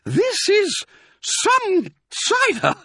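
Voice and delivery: very high, slow cub voice; gasped amazement. A narrator's reading. Smallest fox after a fiery gulp, gasping. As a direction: gasp it out word by word. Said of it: "gasped the smallest fox".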